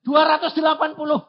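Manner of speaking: high, fast excited shout; eager shout. Retold or imitated. Child shouting the amount to her father. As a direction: blurt the number out.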